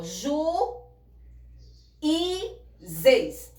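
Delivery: "ju, i, zeis" em três partes, com o i agudo e isolado; sílabas separadas. Shown as a demonstration. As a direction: separe "ju, i, zeis", subindo no i e caindo forte no zeis. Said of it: "você separa a sílaba". ("ju, i, zeis" silabado, com pausas)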